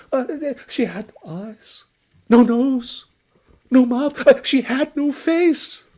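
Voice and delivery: high, loud stammering terror; panicked stammer. Acted. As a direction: stumble over the words in fright. Merchant describes ghost.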